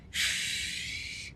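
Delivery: a whispered shh; hushed shh. Acted. Squirrels hushing each other about Bear, whispered.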